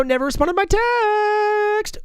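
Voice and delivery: very high, slow, drawn-out squeak; mocking falsetto. Host mocking the guest who ignored his text, squeaky falsetto. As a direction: stretch it out in a teasing squeal. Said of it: "mocking the no-show guest".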